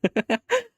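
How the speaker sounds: loud, high giggle; bright burst of giggling; real laugh. Real, not acted. Laughs after shushing friend.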